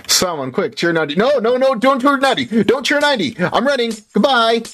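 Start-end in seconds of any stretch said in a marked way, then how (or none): 1.1-4.6 high panicked nopes while fleeing; frantic, running for your life; scared horror game streamer. Real, not acted. Panicked fleeing from chat threat.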